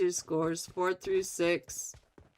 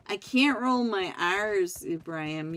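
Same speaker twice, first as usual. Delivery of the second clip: ordinary talk, nothing marked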